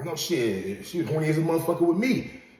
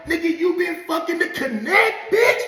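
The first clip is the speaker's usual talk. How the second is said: much higher, half-shouted disbelief; shocked inner outburst; 10 semitones higher than usual. Retold or imitated. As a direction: yell it in your head, stunned and furious. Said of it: "This is what I'm thinking in my head".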